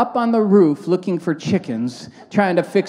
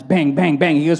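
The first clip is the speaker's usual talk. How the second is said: ordinary talk, nothing marked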